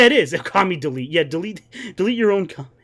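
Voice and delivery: normal talking voice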